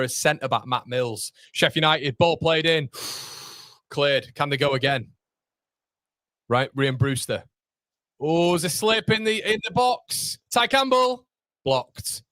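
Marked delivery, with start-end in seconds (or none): 1.5-12.2 rising excitement as the chance builds, higher at the slip; building commentary that peaks at Ty Campbell; live commentary. Acted. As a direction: call the goalmouth scramble as it happens.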